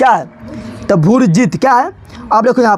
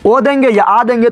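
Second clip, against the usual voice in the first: usual speaking voice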